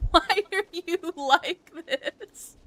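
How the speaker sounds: high, loud, drawn-out laughing plea; slow wail dissolving into laughter; laughing exasperation. Retold or imitated. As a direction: crack up while asking in mock despair.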